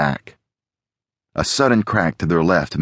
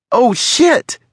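high, loud alarmed curse; Alex, alarmed; 5 dB louder than usual. A narrator's reading. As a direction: exclaim in alarm, pitched way up. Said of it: "Alex breathed".

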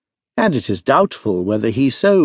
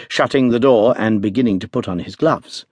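ordinary delivery, nothing marked

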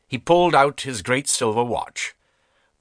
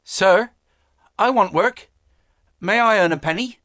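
put-on high, earnest boy's voice; a hungry lad bravely speaking up, pitched high; courageous poor boy; 9 semitones higher, 4 dB louder than usual. A narrator's reading. Poor boy asks the stern master for work, high earnest boy voice. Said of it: "mustered up his courage and voice".

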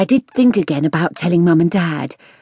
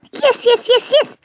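high squeaking little animal voice; a guinea pig squeaking with glee; squeaky guinea pig. A narrator's reading. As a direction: squeak it out, tiny and eager. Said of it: "squeaked Mister Keylock".

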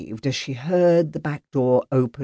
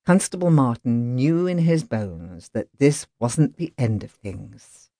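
plain reading voice

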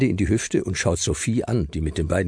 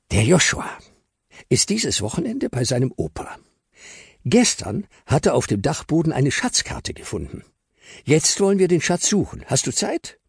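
put-on higher kid voice, lively and eager to explain; eager boy explaining; 9 semitones higher than usual. Justus explains the treasure hunt to Luis, eager. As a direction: pitch the adventure to a friend.

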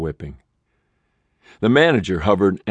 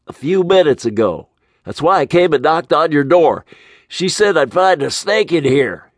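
high, louder, jittery manager voice; nervous motel manager; 9 semitones higher, 5 dB louder than usual. Acted. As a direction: blurt it out, rattled and pitched up.

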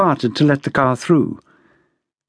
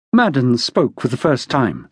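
ordinary delivery, nothing marked

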